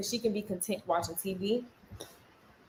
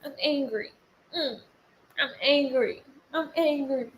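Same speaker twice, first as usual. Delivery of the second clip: higher, lightly voiced child declaring anger; imitated little child; 5 semitones higher, 3 dB quieter than usual. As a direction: mimic a kid's mock grumpiness. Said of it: "she loves to say".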